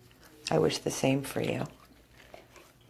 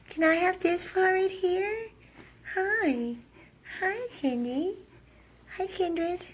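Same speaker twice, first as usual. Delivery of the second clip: much higher sing-song greeting to the dog; high pet talk; slower than usual. Real, not acted.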